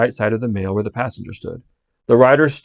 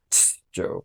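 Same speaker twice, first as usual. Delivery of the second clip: soft, hushed warning hiss; warning whisper; 11 dB quieter than usual. Acted. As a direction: hush him urgently under your breath. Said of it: "cried a coachman in a warning voice".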